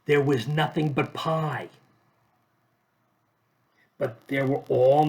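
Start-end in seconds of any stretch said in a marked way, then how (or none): none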